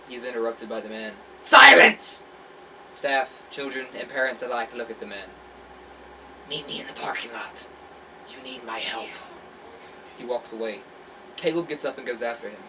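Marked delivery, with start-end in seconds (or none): 1.4-2.2 high, very loud strange-voiced shout; booming command. Stranger silences the whole room. 6.5-9.1 hushed, breathy secret instruction; eerie oracle whisper. Acted. Mysterious oracle voice again.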